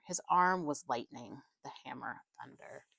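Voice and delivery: plain reading voice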